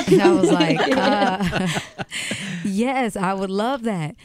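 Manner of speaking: giddy high laughing yes; laughing excitement. Retold or imitated. Singer recalling [name]'s invite, high laughing giddy yes.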